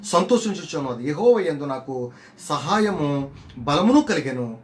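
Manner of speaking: slow, solemn Bible recitation; scripture reading. Acted. Preacher reading Hannah's song to the church, slow solemn. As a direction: read the verses slowly as sacred words.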